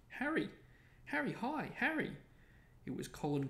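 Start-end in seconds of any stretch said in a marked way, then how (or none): 0.1-2.2 put-on very high, eager boy's voice; excited calling. A narrator's reading. Colin Creevey hailing Harry, excited high-pitched calls. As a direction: call out high and keen to your idol.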